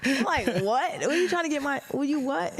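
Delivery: confused retelling to a stranger on the street; baffled. Retold or imitated. Retold reply to the stranger.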